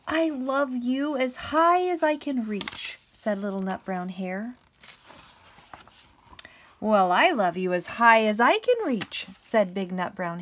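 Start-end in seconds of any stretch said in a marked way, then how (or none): 0.1-3.0 high, loud, slow stretched-out little voice; little hare. Acted. Little hare declaring love to big hare, high eager voice. Little hare's line in story. 6.8-9.3 big hare. Acted. Big hare outdoing little hare's reach, big loud voice.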